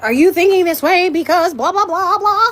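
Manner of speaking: high-pitched overanalyzing mom; fretting mom. Acted. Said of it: "as a mom, it's like".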